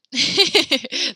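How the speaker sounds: high, loud giggle; amused laugh. Real, not acted. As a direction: burst into a light, high laugh. Amused by a costume answer.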